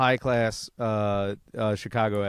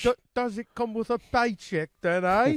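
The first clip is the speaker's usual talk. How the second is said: high put-on actor voice, teasing; mock Andrew Garfield; 10 semitones higher than usual. Host mocking the Spider-Man actor, high put-on voice. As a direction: lampoon a vain actor asking about pay. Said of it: "I'm the second Spider-Man".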